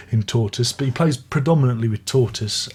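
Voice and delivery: usual speaking voice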